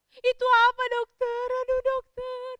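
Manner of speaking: loud, high, hammed-up tearful cry; panicked wail. Mother asks the doctor what chemotherapy is, wailing in panic. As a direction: wail the question in distress.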